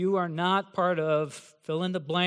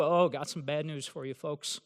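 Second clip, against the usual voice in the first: ordinary talk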